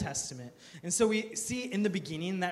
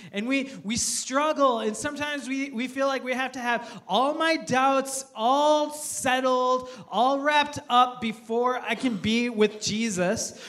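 raised, higher pitched preaching push; impassioned pulpit appeal, louder and higher; fervent preaching; 5 semitones higher, 4 dB louder than usual. Acted. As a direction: press the congregation hard, slowing on key words. Preaching builds to full force.

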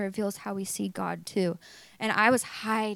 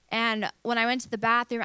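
ordinary delivery

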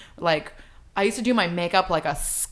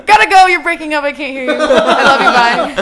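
loud, fast fake bad-connection excuse; call dropping out; 7 semitones higher, 12 dB louder than usual. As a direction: rush off the phone pretending the line is breaking. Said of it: "I'm going through a tunnel".

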